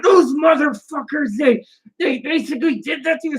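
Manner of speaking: high-pitched retold outrage; imitated fury. Retelling how Chloe got mad about billing, imitated fury. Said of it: "she was furious. She's like".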